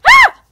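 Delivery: sudden high yelp; startled high scream. Real, not acted. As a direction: yelp as you get hit. Killed in game.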